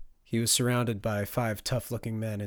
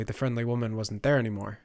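usual speaking voice

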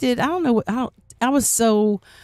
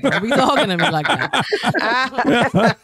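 slow, laughing playful scold; laughing mock scold; 5 semitones higher, 3 dB louder, much slower than usual. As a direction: tease him with a slow laugh.

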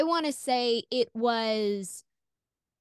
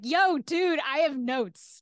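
higher, casual and cocky; cheeky callback; 5 semitones higher, slower than usual. Pretend. Imagined call back to the doctor, cocky and higher. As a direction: lay it on him breezily. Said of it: "call him again and be like".

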